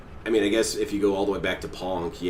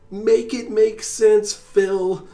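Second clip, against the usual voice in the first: much higher and louder, slow and strained; full scream; 12 semitones higher, 8 dB louder, much slower than usual. Retold or imitated. Imagined yell at Phil, high, loud and slow. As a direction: let it burst out as a yell. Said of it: "scream at him".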